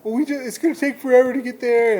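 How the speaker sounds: high-pitched mock complaint; freaking out. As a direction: imitate whining, worked-up kids. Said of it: "everybody was freaking out".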